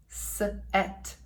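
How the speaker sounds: "s-et" split in two and breathed softly; whispered sounding out. Shown as a demonstration. Teacher sounding out a word for pupils, softly whispered.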